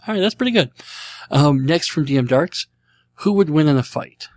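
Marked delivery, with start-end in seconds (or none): none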